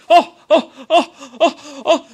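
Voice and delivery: very loud, high staccato ohs; exaggerated loud squawked ohs; mock acting exclamations. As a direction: burst out in hammed-up high ohs. Mocking what passes for acting.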